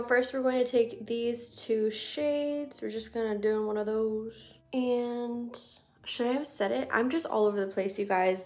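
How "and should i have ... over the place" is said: ordinary talk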